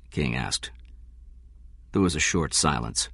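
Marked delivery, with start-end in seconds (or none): none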